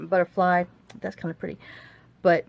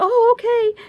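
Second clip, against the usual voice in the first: much higher, louder, put-on Chinese-accented voice; imitating Bunny's Chinese accent; 7 dB louder, slower than usual. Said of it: "she still has her little accent and stuff".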